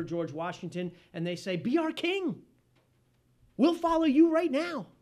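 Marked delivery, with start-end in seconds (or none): 1.6-4.9 higher, louder eager shout of the men; eager plea. Retold or imitated. Soldiers urge George Washington to take the crown, eager. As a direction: call out to make him king.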